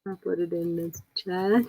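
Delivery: usual speaking voice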